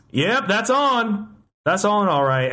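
slow, drawn-out Southern drawl; lazy Texas repairman twang; southern accent. Retold or imitated. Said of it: "the most southern accent that I've ever heard".

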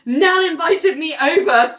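lightly mimicked megaphone call, pitched high; megaphone announcement. John telling everyone through a megaphone, mock announcement. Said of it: "through the megaphone".

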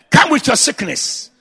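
usual speaking voice

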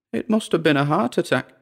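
high-pitched character voice; Dora's thought. A narrator's reading. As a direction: think it aloud in a high voice. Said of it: "Dora thought".

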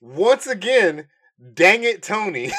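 laughing exasperation. Real, not acted. Exasperated joke.

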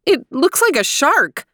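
high, alarmed mother; nervous mother squeaking out; shrill. Acted. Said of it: "shrilled Mommy".

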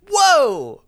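high, loud cheer; excited whoop. Real, not acted. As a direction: whoop at the winning kill. Player's kill confirmed by game master.

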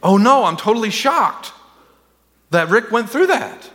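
hammed-up dismay from heaven; mock shocked God. Preacher mocking the idea of God caught off guard, surprised. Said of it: "God up in heaven saying".

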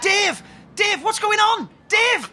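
high, urgent shouts; alarmed calling out. Acted. Man calling to Dave over the phone, alarmed. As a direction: call out sharply for an answer.

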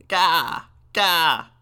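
put-on loud, high baby-raven wail; wailing raven caw. A narrator's reading. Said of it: "The baby began to wail again".

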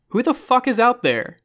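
high, loud demand; angry shout. A narrator's reading. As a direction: shout a furious challenge into the dark. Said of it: "John shouted".

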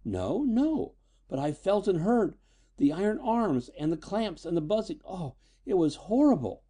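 put-on high-pitched, horrified girl; rising hysteria. A narrator's reading. Daughter describes the invisible attacker to her father, hysterical. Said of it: "The girl's voice rose hysterically".

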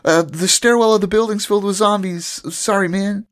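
high, easygoing dude voice, apologetic; high casual bro apologizing; chill bro voice. Retold or imitated. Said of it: "And Tendo's like".

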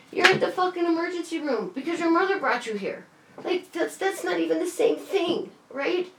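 high, exasperated scolding; frustrated outburst. Retold or imitated. Scolding her boyfriend at the emergency room, pitched high and furious. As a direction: throw it at him, fed up and incredulous.

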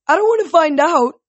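high, loud called-out reply; urgent shout back. A narrator's reading. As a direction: shout the answer back mid-flight. Shouted exchange while flying on wings.

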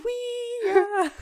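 high, loud mock yell; battle cry. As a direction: shout it like a goofy ad.

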